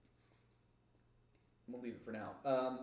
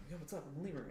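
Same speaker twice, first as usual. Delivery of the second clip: high, laughing critter voice; chirpy little lemur talking; 6 semitones higher, much faster than usual. Acted. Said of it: "She's like".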